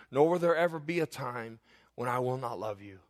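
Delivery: normal talking voice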